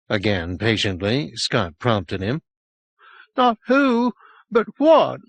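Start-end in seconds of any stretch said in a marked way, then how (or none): 3.4-5.2 higher, louder correction; insistent boy. A narrator's reading. Boy corrects the sergeant, higher and insistent. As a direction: correct him firmly.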